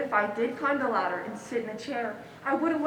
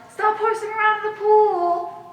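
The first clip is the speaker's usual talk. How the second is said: much higher and louder yell; mock lifeguard yell; 10 semitones higher, 9 dB louder than usual. Lifeguard shouting at kids in the pool, high loud yell. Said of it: "blow a whistle and yell".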